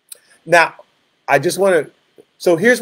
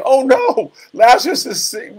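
high-pitched, hammed-up dismay; mock distress; 8 semitones higher than usual. Preacher showing what Jesus was not doing, mock wailing. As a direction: pretend to panic on the edge of tears. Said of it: "and start crying".

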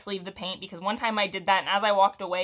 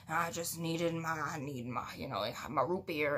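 sheepish mumble; 4 semitones lower, 6 dB quieter than usual. Retold or imitated. Customer calms, mumbling excuse.

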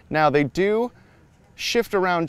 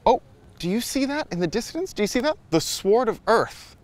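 excited; 7 semitones higher than usual. Real, not acted. Spotting the sword in distance.

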